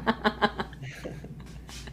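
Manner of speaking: breathy laughing fit; burst of laughter. Real, not acted. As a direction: laugh it out at the joke. Brother laughing at apartment joke.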